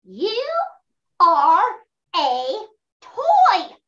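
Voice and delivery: each word set apart, toy punched high and loud; slow, spaced reveal. Acted. Toy telling the hero what he really is, slow spaced reveal. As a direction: drop the truth word by word.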